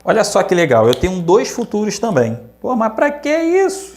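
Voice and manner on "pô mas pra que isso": lightly voiced, much higher pitched student protest; a hint of a doubtful student asking why; skeptical student. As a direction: pipe up like a puzzled pupil.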